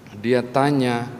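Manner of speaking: normal talking voice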